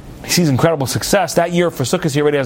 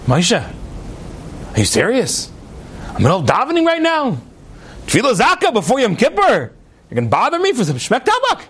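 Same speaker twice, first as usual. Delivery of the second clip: incredulous rebuke; 5 semitones higher than usual. Acted. Rich man interrupted at prayer by Moshe, indignant. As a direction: act affronted, pitch leaping up after the first question.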